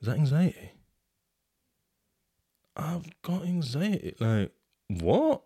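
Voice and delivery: rising, slowing dawning shock; stunned to learn he has anxiety; retold realisation. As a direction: let the truth land slowly, half amazed. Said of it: "I thought".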